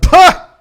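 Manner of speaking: a sudden, very loud and high laugh burst; burst of laughter. Retold or imitated. In court, a loud laugh bursting out. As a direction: let one explosive "Pah" escape. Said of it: "the first time I laughed, like, out loud".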